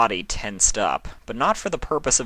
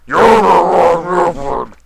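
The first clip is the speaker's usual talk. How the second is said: much higher, louder, slow; shouting; 9 semitones higher, 11 dB louder, much slower than usual. A narrator's reading. Sombra shouts at Nightmare Moon, loud. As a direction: bellow it at the table.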